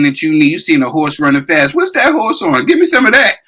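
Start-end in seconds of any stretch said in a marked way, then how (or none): 1.7-3.4 high-pitched, eager mock quote; mock eager demand. Mocking a cheater who wants whatever the horse is on, mock eager. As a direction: put on a greedy cheater's excited demand.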